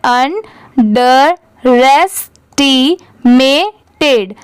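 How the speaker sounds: अंडरएस्टिमेटेड very slowly, a gap after each syllable; teacher's piece-by-piece अंडरएस्टिमेटेड; divided into parts. Shown as a demonstration. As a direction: separate अंडरएस्टिमेटेड as अन, ड, रेस, टी, मे, टेड. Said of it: "और भी part में divide कर दिया".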